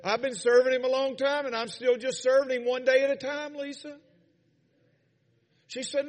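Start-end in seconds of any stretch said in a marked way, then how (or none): 0.0-4.0 high, loud, emotional retelling; happy-tearful. Retold or imitated. New believer crying and smiling, happy-tearful. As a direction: imitate someone overcome with joy. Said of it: "we're crying and we're smiling".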